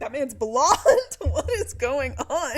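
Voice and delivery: loud, laughing, incredulous; laughing disbelief. Retold or imitated. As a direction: act baffled at the reveal. Reacting to the blonde reveal.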